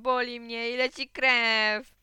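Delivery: put-on loud, slow child's wail; wailing shout. A narrator's reading. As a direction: complain at full voice, dragging the words out. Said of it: "krzyknąłem". (głośne, przeciągłe zawodzenie dziecka)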